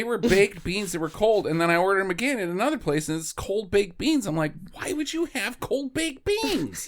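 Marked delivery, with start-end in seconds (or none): 4.7-6.9 high, drawn-out disbelief; mock bafflement. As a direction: question it slowly in exaggerated bewilderment. Baffled at a strange breakfast, retold.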